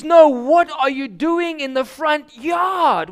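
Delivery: high, loud, slow scolding shout; scolding lady. Retold or imitated. Homeowner scolding kids in her yard, loud alarmed shout.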